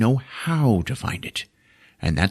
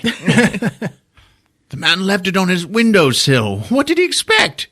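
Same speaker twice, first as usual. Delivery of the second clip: pitched-up haughty excuse; fussy wizard voice; 9 semitones higher than usual. Retold or imitated. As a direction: huff it like an offended little wizard. Comic wizard voice continues.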